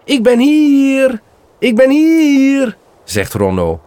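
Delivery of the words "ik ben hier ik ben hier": very high, slow and louder lure; imitated calling voice. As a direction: mimic a sing-song call from afar. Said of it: "een stem die riep".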